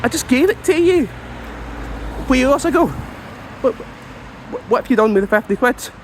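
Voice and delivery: high, disbelieving outburst, picking up speed; hammed-up fury at the lost money; outraged. Outraged at homeless guy.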